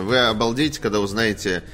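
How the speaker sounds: usual speaking voice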